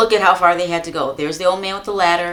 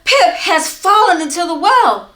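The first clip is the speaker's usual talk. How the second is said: high urgent cry of bad news; breathless alarm; 9 semitones higher, 5 dB louder than usual. Little boy blurting news to his mother, alarmed. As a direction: blurt it out in a panic.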